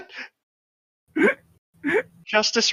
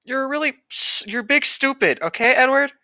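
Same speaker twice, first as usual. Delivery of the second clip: higher, mocking schoolyard insult; childish taunt; 6 semitones higher than usual. Mocking Edward in the roleplay, childish taunt. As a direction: tease him like a sulky kid.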